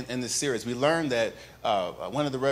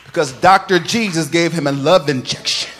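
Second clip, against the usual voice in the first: full-force preaching; 3 semitones higher, 12 dB louder than usual. Acted. Pastor firing up the congregation about healing, loud chanted preaching. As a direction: lift the whole church with a sung, rising proclamation.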